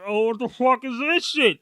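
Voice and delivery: high-pitched mock outrage; imitated alarm. Daughter reacting to the x-ray gear, mock alarm. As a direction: play the bewildered kid protesting. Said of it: "She's like".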